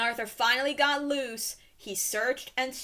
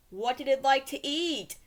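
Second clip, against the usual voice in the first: ordinary delivery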